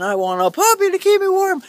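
high, put-on pleading voice; cutesy plea. Vlogger who lives alone wanting a puppy, cutesy plea. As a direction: climb up high like a begging kid.

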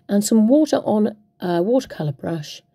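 normal talking voice

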